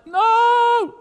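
a sudden high, loud cry of no; panicked shout. Retold or imitated. A man panicking at God's order, high shout. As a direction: yell it in alarm.

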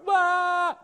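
high wailing cry; a comic wail of complaint; high loud mock wail. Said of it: "Mark's going".

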